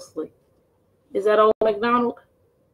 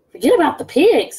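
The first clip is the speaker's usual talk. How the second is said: brighter, louder echo of the wolf; Gritch's reply; 4 semitones higher, 8 dB louder than usual. Acted. Gritch repeating the wolf's advice, louder. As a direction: toss the line back brightly. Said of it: "said Gritch".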